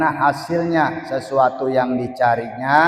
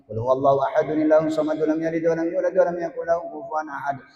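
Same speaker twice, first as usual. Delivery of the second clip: rushed recitation; much faster than usual. Shown as a demonstration. Worshipper hurrying through Fatihah and Ikhlas in prayer, rushed. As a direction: race through the prayer verses without pausing. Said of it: "terburu-buru lagi bacanya".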